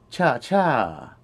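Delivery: "Cha cha" doubled for emphasis; emphatic doubling. Shown as a demonstration. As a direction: say "Cha cha" twice over, deliberately. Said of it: "to emphasize the adjective".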